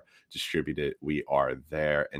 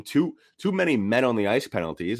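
ordinary talk, nothing marked

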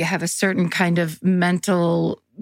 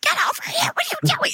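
whisper yelling. Retold or imitated. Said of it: "I'm trying to whisper, scream".

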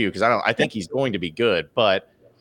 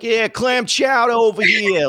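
hammed-up Boston shout, much higher and louder, slow; mock Boston accent; 12 semitones higher, 4 dB louder, slower than usual. Mocking a coach faking Boston, loud hammed-up accent. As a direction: belt it like a tourist faking Boston. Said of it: "he's just like".

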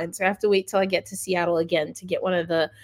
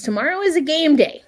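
ordinary talk, nothing marked

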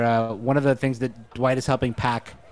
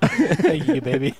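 [name] voice; slower than usual. Retold or imitated.

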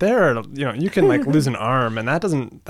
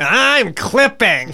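nasal voice; 6 semitones higher, 4 dB louder, much slower than usual. Pretend. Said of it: "we're all practicing our nasally voices".

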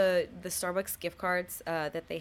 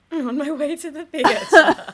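laughing; 7 semitones higher, 13 dB louder than usual. Real, not acted. Echoing with a laugh.

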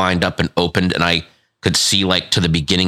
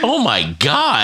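stunned; 6 semitones higher, slower than usual. Real, not acted. Reacting to the grim story.

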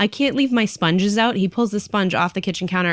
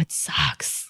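soft, whispered resignation; real whisper; 5 semitones lower, 7 dB quieter than usual. Real, not acted. Owner whispering her grief about her sick cat. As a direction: let it out in a hushed breath.

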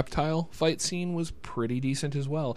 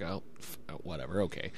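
resigned; 4 semitones lower, 6 dB quieter than usual. Retold or imitated. Weary actor giving in.

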